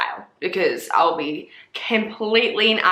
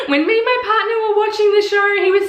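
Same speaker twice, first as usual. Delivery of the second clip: laughing; 11 semitones higher, 5 dB louder than usual. Real, not acted. Laughing while telling the story.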